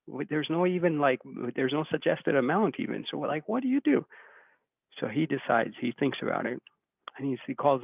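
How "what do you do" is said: high, baffled what do you do; baffled question. Retold or imitated. Volunteers asked what to pay, a high baffled question. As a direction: throw up your hands, lost for an answer.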